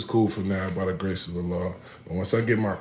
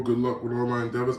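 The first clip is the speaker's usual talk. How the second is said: normal talking voice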